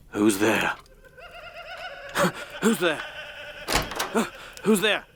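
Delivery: gasping, rising panic at the door; frightened calls. Acted. Alone at night, hearing knocking, calling out in fear. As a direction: call out into the dark, scared.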